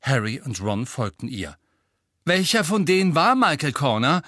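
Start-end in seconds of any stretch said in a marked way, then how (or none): 2.2-4.2 laut, höher, aufgebracht gefragt. A narrator's reading. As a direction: aufgebracht nachhaken. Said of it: "wollte Ron aufgebracht wissen". (aufgebracht und laut)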